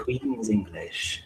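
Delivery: slow mock-posh English echo; Queen's English accent. Playing along with co-host, slow Queen's English accent.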